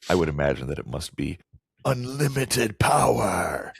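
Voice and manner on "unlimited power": high, drawn-out villain cry; Emperor Palpatine. Acted. Host doing his emperor impression for co-host, theatrical villain cry. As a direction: proclaim it as a power-mad evil emperor. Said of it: "Emperor Palpatine impression".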